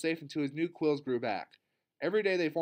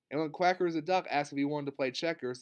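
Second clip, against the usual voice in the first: plain reading voice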